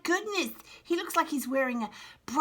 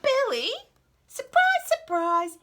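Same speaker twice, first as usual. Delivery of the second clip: high and loud, playing up the reveal; spring it on them like peekaboo; excited surprise; 5 dB louder than usual. Reading to a child, a loud high surprise reveal.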